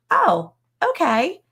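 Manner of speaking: slow, high-pitched retold delight; dawning excitement. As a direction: imitate a mom slowly warming to the idea. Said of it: "hear that excitement in her voice".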